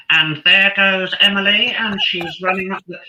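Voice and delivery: put-on childhood commentary in a posh home counties voice; home counties accent. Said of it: "in my sort of quite posh home counties voice".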